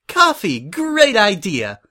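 high, loud burst of eager delight; excited exclamation. A narrator's reading. Suri jumps on Prim's coffee request, exclaiming eagerly. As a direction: exclaim it brightly, stretching the praise. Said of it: "Suri exclaimed".

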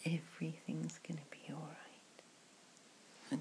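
hushed ASMR whisper, close to the mic; soft whisper. Acted. To a friend at the pub, whispered ASMR comfort. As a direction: breathe the words softly like a secret.

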